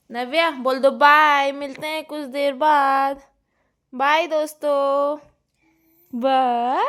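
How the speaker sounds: put-on high, loud, slow sing-song goodbye on the baby's behalf; baby talk. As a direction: coax the toddler to wave bye. Prompting baby to say bye. (बच्ची की तरफ़ से ऊँची, धीमी तोतली आवाज़ में bye)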